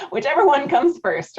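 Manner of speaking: amused repeat. Real, not acted.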